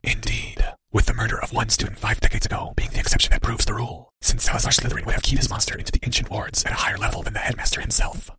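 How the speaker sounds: soft, voiceless villain's whisper; whispery. A narrator's reading. Cloaked figure corrects the boy, sinister whisper. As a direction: whisper it all without voice. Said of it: "gave a whispery chuckle".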